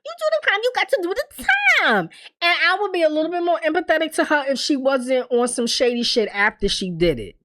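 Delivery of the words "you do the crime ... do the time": very high, squealed insistence; exasperated scolding. Real, not acted. Viewer insisting the culprit serve time, exasperated. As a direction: squeal it out in disbelief.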